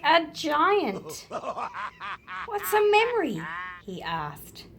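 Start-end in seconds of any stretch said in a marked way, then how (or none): 1.3-2.4 quick breathy chuckle; a small amused laugh; soft laugh. Real, not acted. Laugh after giant voice line. 2.5-3.4 put-on lightly raised, childlike question; higher, eager child asking; small boy's voice. Boy asks Mister Drysdale.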